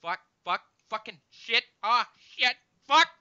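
rising string of curses; swearing outburst. Retold or imitated. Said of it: "I would always say".

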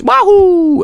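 Mario yell. Retold or imitated. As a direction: squeal it like Mario jumping. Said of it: "something you'd make in Mario sixty-four".

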